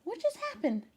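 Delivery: high sing-song pet voice asking the cat what happened; pet talk. Real, not acted. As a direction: coo at a startled kitty. Talking to her startled cat.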